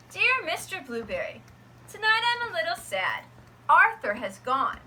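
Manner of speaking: plain reading voice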